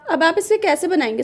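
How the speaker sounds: normal talking voice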